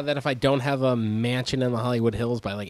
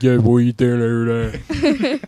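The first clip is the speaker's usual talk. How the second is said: filtered voice; 3 semitones lower, 8 dB louder than usual. Retold or imitated. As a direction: mimic a phone filter, Philly style. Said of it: "We'll throw filters on it".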